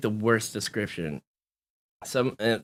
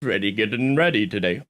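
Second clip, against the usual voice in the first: ordinary talk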